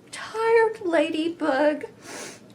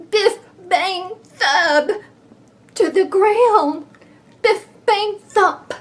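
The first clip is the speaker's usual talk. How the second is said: louder, higher biff bang thud; crash words; 7 semitones higher, 5 dB louder than usual. Acted. Bee tumbling to the ground, loud and high. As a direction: punch out the crash.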